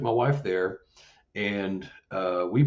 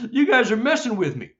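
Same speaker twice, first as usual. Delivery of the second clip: retold accusing call; 11 semitones higher, 5 dB louder than usual. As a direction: call out to the culprits, pitch well up. Repeats accusation to the creatures.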